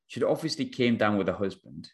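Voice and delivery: normal talking voice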